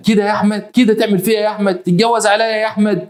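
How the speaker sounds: high, wailing-style mock reproach; betrayed wife's reproach. Wife reproaches husband for marrying another, high aggrieved cry.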